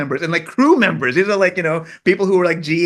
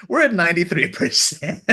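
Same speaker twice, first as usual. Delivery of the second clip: real laughter through the words; delighted laugh at the score; laughing report; 4 semitones higher than usual. Real, not acted. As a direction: laugh as you announce it.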